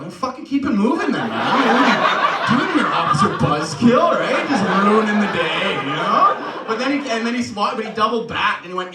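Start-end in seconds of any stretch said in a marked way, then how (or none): 0.0-1.6 high, loud comic outburst; hammed-up angry yell; mock indignant shout. Acted yelling at the officer. 1.6-6.3 loud, high-pitched comic scolding; hammed-up indignant yelling; mock outraged rant. Acted telling off the officer.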